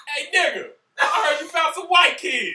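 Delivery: high, loud mock raptor greeting. Raptor talking to the kids, high and loud. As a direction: ham up a raptor calling out to its prey. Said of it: "They're like".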